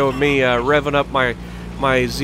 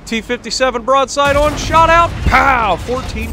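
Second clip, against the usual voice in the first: rising, much louder calling out of the shot; excited play call; 7 semitones higher, 4 dB louder than usual. Real, not acted. Gamer calling his own tank shot to viewers, excited loud and high. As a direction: call the hit like a hype moment.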